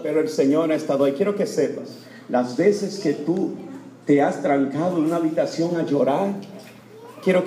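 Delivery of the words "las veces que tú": ordinary talk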